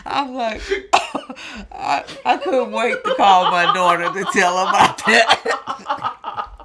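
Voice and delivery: real high laughter between words; laughing. Real, not acted. Mother cracking up over her son.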